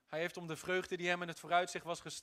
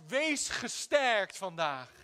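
drawn out and louder, for the congregation to repeat; a slow, louder blessing to pass on; slow, loud encouragement; 7 dB louder, much slower than usual. Shown as a demonstration. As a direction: stretch each word of cheer out loud. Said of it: "Zeg tegen iemand anders".

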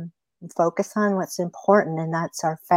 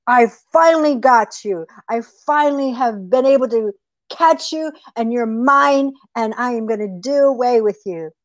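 raised, triumphant coyote voice; gloating coyote; 4 semitones higher than usual. Acted. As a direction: crow over the prey you have caught. Coyote gloats over the caught rabbit.

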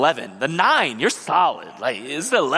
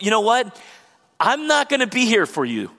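higher, offhand mock brush-off; dismissive brush-off; 6 semitones higher than usual. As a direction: lightly play a careless child shrugging off the parents. Voicing child abandoning elderly parents.

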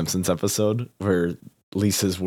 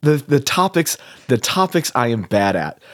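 flustered; 6 semitones higher, 5 dB louder than usual. Real, not acted. Flustered protest.

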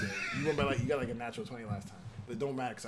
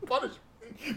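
laughing; 11 semitones higher, much slower than usual. Pretend. Mock plea, laughing.

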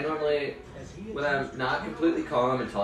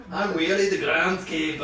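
higher, hammed-up Scottish cartoon voice; mock Scottish burr, pitched up; Groundskeeper Willie; 4 semitones higher, 4 dB louder than usual. Teasing co-host's Scottish slip, cartoon Scotsman impression. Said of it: "Willie of The Simpsons".

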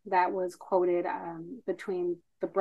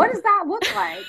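mock wonder; 14 semitones higher, 8 dB louder than usual. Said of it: "playful mock question".

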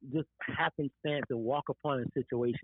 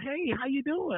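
cheerful greeting; 11 semitones higher than usual. Retold or imitated. Doctor greets him cheerfully.

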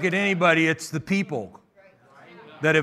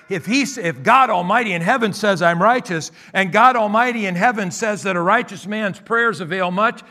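higher, louder preaching climax; full-force preaching; 4 semitones higher, 4 dB louder than usual. Acted. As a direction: build the sermon to its peak.

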